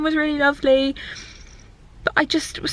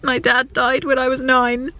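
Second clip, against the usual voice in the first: ordinary talk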